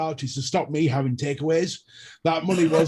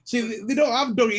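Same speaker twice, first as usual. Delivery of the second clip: ordinary talk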